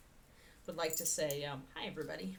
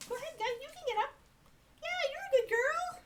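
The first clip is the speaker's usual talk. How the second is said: high praise for the dog; faster than usual. Real, not acted. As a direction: reward the pup in a sing-song.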